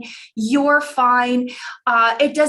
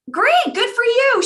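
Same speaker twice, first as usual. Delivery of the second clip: upbeat mock cheer; 12 semitones higher, 3 dB louder than usual. Mother's breezy reply to the bad grade.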